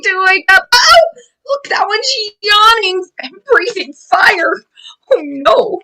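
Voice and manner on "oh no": ordinary delivery, nothing marked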